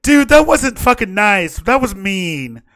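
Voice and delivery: high, loud, wounded protest; hurt voice. Acted. Heard by all, Logan protests loud and high. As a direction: whine it out, offended and slow. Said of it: "You guys all just hear".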